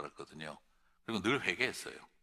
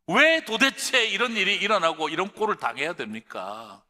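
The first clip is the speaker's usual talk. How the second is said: loud, high cry of grievance; acted protest; 8 semitones higher, 8 dB louder than usual. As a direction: demand an explanation, voice raised in complaint. Preacher acts out complaint to God.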